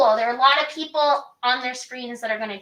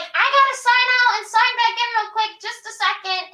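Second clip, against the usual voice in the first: higher, louder acted aside; improvised excuse; 8 semitones higher, 4 dB louder than usual. Shown as a demonstration. Coach showing cast how to cover a glitch, improvised excuse. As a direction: toss it off as an in-show excuse.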